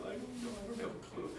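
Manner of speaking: high, soft aw-shucks voice; playful modest reply. Retold or imitated. Teller answers Mitz's praise, high and soft.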